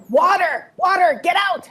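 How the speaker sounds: high-pitched imitated cry of alarm; retold yelling. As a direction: call out like a panicked passenger, without full volume. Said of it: "starts yelling".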